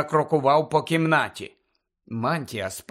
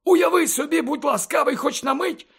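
much higher than narration, urgent mentor; Dumbledore's insistent persuasion; 11 semitones higher than usual. Acted. Headmaster asks pupil to imagine, insistent and earnest. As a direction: reason with the pupil emphatically.

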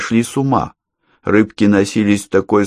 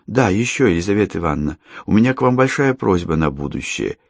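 ordinary delivery

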